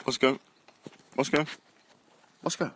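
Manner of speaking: loud, high call across the garden; calling the dog. Real, not acted. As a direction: shout the dog's name to bring him over.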